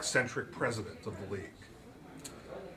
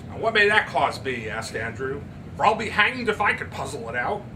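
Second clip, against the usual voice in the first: scoffing father; 3 semitones higher, 8 dB louder than usual. Acted. Andrew to Miss Turpin, loudly scoffing. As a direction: scoff at the cause.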